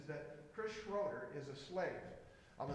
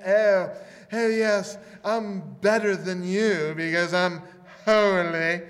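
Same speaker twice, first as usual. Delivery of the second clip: louder, slow, smug mock superiority; snooty holier-than-thou; 2 semitones higher, 16 dB louder, slower than usual. Preacher mocking the proud believer, loud and smug. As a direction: ham up a self-righteous snob looking down. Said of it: "doesn't walk around saying".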